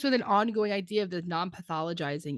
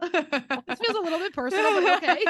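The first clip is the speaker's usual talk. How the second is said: higher, amused, laughing at the end; 9 semitones higher, 5 dB louder than usual. Real, not acted. Cohost joking she feels called out, higher amused voice. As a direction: play at being hurt while giggling.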